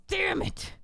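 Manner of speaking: sharp high cry of frustration; a cornered man exploding in frustration; frustrated outburst. Acted. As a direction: curse as the fist comes down. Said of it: "He bangs his fist".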